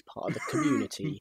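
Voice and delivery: enunciated with mild disdain; pointed, careful "community". Real, not acted. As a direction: set the phrase apart, over-enunciating "community". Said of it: "you say it with such disdain".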